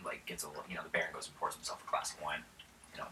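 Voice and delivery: ordinary talk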